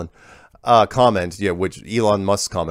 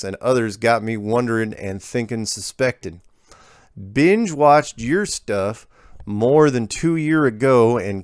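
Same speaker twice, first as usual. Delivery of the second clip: put-on folksy rural accent; slower than usual. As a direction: rural American drawl, "follered" stretched out. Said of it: "Whoever's writing this is brilliant".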